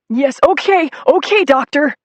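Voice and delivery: high, loud, eager compliance; meek childlike Nettie. Acted. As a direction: give in like a scolded child. Nettie gives in.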